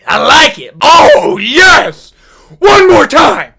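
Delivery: high, loud ecstatic cry; ecstatic yelling. Acted. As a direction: cry out in hammed-up pleasure. Badger begs for more stings.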